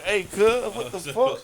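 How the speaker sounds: high, loud startled yelp of outrage; shocked protest. Retold or imitated. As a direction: replay waking up in alarmed disbelief. Retells waking to a painful exam.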